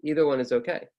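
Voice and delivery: ordinary talk, nothing marked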